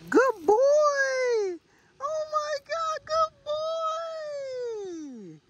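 very high squeal of praise; squeaky praising a dog. Real, not acted. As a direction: gush over the dog, way up high.

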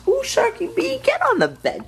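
very high cooing voice for the pet. Real, not acted. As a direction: coo at the cat sky-high. Baby voice talking to pet.

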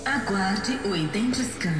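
loud, distant automated system voice; machine announcement voice. Acted. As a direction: read it like a computer PA.

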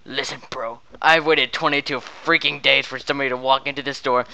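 quiet, threatening undertone; low menacing. Acted. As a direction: drop low and lean in. Salesman turns low and menacing.

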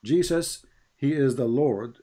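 ordinary talk, nothing marked

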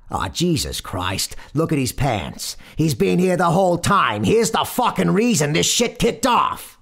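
loud Brooklyn motorman outburst; exasperated rant. A narrator's reading. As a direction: build from disgust to high, loud blame. Brooklyn motorman rants about passenger.

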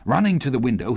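ordinary delivery

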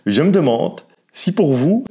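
ordinary talk

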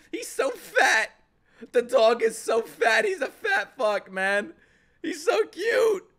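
high-pitched, overwhelmed squeal; gushing over cuteness. Real, not acted. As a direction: squeal over an adorable dog. Gushing over the cute dog.

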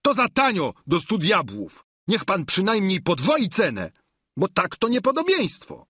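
high-pitched squire crying out in protest; calling out. A narrator's reading. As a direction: haggle loudly in a high voice. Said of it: "zawołał". (podniesiony, wysoki głos protestu)

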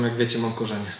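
ordinary delivery

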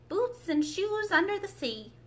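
ordinary delivery

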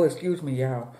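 normal talking voice

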